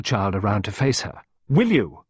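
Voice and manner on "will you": short, loud, high demand; forceful repeated challenge; shouting. A narrator's reading. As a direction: press hard for an answer. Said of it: "Clarissa shouted".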